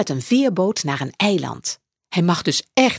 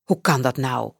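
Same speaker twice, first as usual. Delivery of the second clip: lower, puzzled man's voice; a lower, kindly headmaster asking; principal's question; 6 semitones lower than usual. Acted. Headmaster Janos asks Max, in a lower puzzled voice. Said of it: "vraagt meester Janos".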